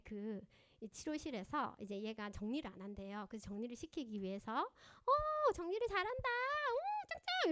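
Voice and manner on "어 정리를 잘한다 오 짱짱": put-on high, bright praise for a child; playful teacher praise; cheerful praise. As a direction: cheer the kid on in a sing-song. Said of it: "이러면서 칭찬을 해주고".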